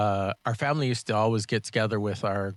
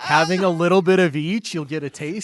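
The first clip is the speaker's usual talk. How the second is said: excited; 8 semitones higher, 11 dB louder than usual. Real, not acted. Excited reply over laughter.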